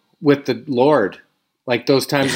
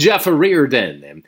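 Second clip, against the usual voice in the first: slow announcer call; 2 semitones lower, much slower than usual. Pretend. Repeats name in announcer style.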